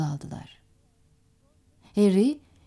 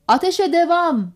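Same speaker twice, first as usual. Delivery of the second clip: higher, forceful order; raised command; 6 semitones higher, 4 dB louder than usual. A narrator's reading. Skipper telling his gunners to keep firing, raised command. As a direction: call it out to the crew.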